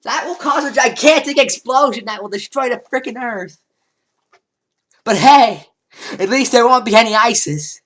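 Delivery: mock-outraged shouting; ranting shout. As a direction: yell it like the earth is doomed. Ranting about a world-ending explosion.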